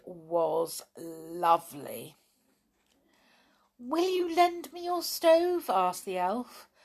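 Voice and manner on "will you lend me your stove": tiny elf voice. Acted. The elf asks.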